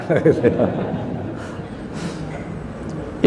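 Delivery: long breathy chuckle; soft laugh. Real, not acted. Preacher laughing at the kepo joke, soft chuckle. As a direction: laugh softly at your own joke.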